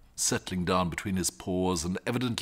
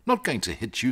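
ordinary delivery, nothing marked

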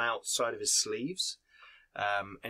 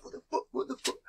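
startled dwarf; 11 semitones higher, 3 dB quieter, faster than usual. Acted.

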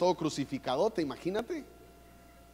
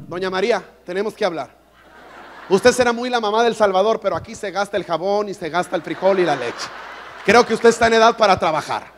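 loud, laughing mock scolding of Doña María; bossy Pedro; 5 semitones higher, 12 dB louder than usual. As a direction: play Pedro lecturing her about money, hamming it up. Pedro scolding Mary in skit.

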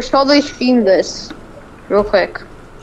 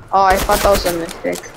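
ordinary talk

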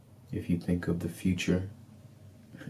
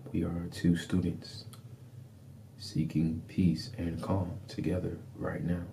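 ordinary delivery

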